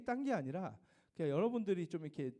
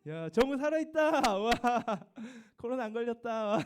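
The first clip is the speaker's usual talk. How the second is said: excited teasing; 5 semitones higher, 7 dB louder than usual. Real, not acted. Teacher teasing a student who answered roll call, excited. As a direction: tease him gleefully for showing up.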